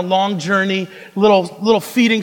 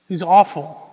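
ordinary talk

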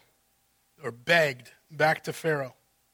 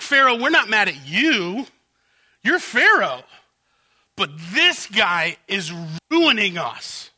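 fawning servants; 5 dB louder than usual. Acted. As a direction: flatter the king, then drag out the complaint.

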